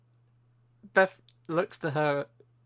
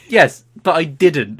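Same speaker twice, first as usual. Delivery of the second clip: slow, louder, mock-defensive; indignant retort; 4 semitones higher, 10 dB louder, slower than usual. Answering his fiancée, a slow, louder mock-indignant retort. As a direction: protest slowly and firmly.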